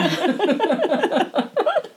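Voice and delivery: high-pitched real laugh. Real, not acted. Storyteller cracks up at her own punchline, laughing.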